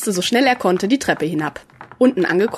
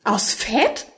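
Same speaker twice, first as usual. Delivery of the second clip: rising, incredulous echo; astonished question; 2 semitones higher, 3 dB quieter than usual. A narrator's reading. Rika questions what the creatures consist of, astonished. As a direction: echo it back with a wondering lift. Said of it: "fragte sie verwundert".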